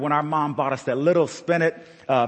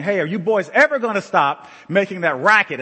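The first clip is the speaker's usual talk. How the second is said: dad's complaint; 5 semitones higher, 5 dB louder than usual. Retold or imitated. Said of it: "my dad saying".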